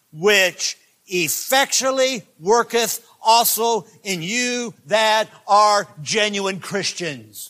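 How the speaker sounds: slow, loud, high scripture read; preaching at full force. Acted. Preacher proclaiming scripture to his church, slow and loud. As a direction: stretch out and boom the words.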